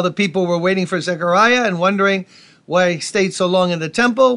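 ordinary talk, nothing marked

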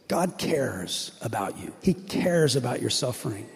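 soft pastoral comfort; tender reassurance. Acted. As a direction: lean in and comfort them gently.

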